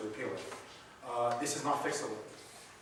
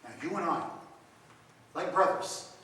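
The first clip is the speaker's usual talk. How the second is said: gentle forgiveness; 7 dB quieter than usual. Retold or imitated. Forgiving the weeping ex-communist.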